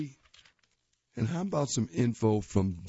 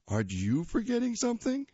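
put-on higher, quick kid voice throwing it back; kid's teasing voice; 10 semitones higher, faster than usual. Tiger teasing his dad back, higher cheeky kid voice. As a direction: copy dad's line as a cheeky boy. Tiger repeats dad's line.

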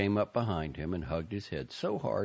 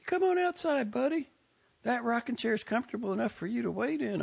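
higher, brisk Southern aunt hurrying him out; impatient aunt. A narrator's reading. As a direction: push him out the door, pitched up and impatient. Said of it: "said impatiently".

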